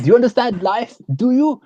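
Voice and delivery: high-pitched imitation of a screamed demand; mock screaming. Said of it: "screaming at her".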